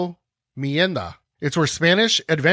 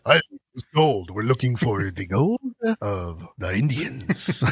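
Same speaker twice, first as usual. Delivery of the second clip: lower, slow mock conquistador; fixed conquistador voice; 4 semitones lower, 3 dB quieter than usual. Host retries the conquistador voice, lower. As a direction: drop the voice into a stern explorer. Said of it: "And he goes".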